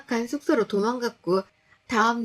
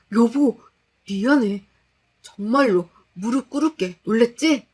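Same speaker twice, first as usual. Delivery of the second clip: louder, pleading apology; begging husband; 5 dB louder than usual. Acted. Husband on his knees begging his scared wife. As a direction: beg her forgiveness on your knees.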